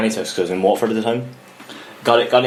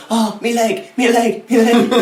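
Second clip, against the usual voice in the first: retold pain cry; 10 semitones higher, slower than usual. Teen on drugs yelps to his nan about his cramp, high and rising. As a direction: cry out over a cramping calf, a bit hammed up.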